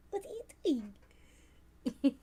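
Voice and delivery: whispered question to the pet; whisper to cat. Real, not acted. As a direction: hush it to the cat.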